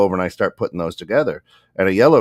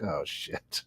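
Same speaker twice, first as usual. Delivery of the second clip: very soft, low aside; muttered dismay; 4 semitones lower, 15 dB quieter than usual. Retold or imitated. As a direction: say it under your breath, walking away. Scott walks away in dismay, retold.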